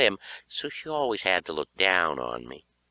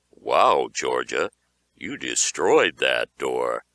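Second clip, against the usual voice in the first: impressed dad; 8 semitones lower, 3 dB louder, slower than usual. Acted.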